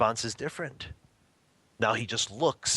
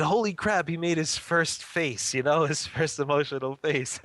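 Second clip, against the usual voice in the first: amazed delight; 6 semitones higher than usual. Retold or imitated. Proud father amazed at his son's first face, high and laughing. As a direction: marvel at the baby's first face. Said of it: "And I said".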